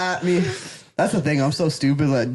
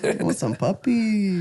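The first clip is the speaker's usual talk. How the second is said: hammed-up Mexican accent, higher and slow; campy Mexican accent; 4 semitones higher, slower than usual. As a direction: stretch puppies in a camp Mexican voice.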